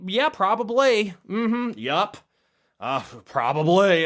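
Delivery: lightly voiced Luann, vague and evasive; Luann impression. Luann answering the coke question, mildly imitated drawled shrug. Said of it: "That's how Luann would say it".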